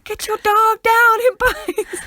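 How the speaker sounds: imitating a panicked dog owner, high and loud; Vine quote voice. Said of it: "quote Vines".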